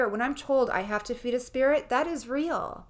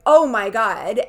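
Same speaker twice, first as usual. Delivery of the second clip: mock yell of shock, louder; retold scream; 11 dB louder than usual. Retelling how she screamed at the spirit, mock yell. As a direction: shout it out startled. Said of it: "So I scream 'cause I'm like".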